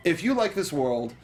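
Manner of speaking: ordinary delivery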